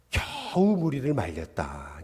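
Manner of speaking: 겨우 stretched out slowly, quietly; slow, soft quote dwelling on 겨우; drawn-out emphasis. Retold or imitated. Preacher quoting the verse to listeners, 겨우 drawn out.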